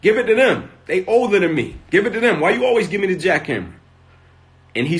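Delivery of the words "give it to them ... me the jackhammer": put-on higher and louder, a frustrated boy pleading; retold mad kid. Boy complains to his uncle about always getting the jackhammer, higher and louder. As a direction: complain like a fed-up kid, voice climbing. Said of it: "And I'm mad".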